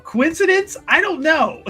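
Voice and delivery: high, loud feigned innocence; mock wondering. Player hints the mages are behind it, mock wondering. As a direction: play dumb, pitch leaping up loudly.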